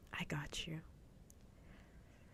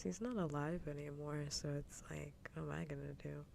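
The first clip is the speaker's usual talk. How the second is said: ordinary delivery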